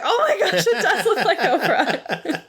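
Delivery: high, laughing surprised exclaim; high amused disbelief; amazed. Retold or imitated. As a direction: gasp it out, delighted by the resemblance. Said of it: "I was like".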